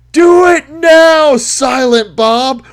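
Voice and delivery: loud, high and slow, each word stretched; slow drawn-out yell. Retold or imitated. Crank yelling a drawn-out signal to his accomplice, loud and slow. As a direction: drag the yell out like a war cry. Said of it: "Then he yells again".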